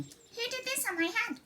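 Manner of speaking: high eager mole question; a small mole bursting with hope; excited little mole. Acted. Mole asking the flies, high and eager. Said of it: "he asked excitedly".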